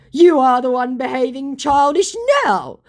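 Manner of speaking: put-on high, loud childish retort; hammed-up petulant child; mock ten-year-old. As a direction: throw it back like an offended kid. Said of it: "said my ten-year-old scammer".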